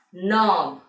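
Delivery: "knob" said as nob, with no K sound; silent K. Shown as a demonstration. Teacher naming a four-letter word, K left silent. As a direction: drop the K in "knob", start on N. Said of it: "while pronouncing the alphabet K is silent".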